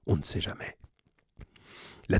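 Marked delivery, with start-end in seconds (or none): 0.1-0.8 aparté chuchoté, complice; glisse-le tout bas comme un secret. Real, not acted. Aparté soupçonneux du lecteur. (on ne sait jamais, murmuré en confidence)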